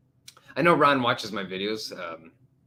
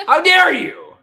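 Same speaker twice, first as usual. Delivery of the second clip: loud, high indignant shout; mock outrage; 14 dB louder, slower than usual. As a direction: shout it as if scandalized. Mock offence at age question.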